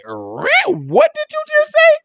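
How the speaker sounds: high shocked squeal of disbelief. Retold or imitated. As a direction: leap way up in shock. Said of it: "They gonna be looking like".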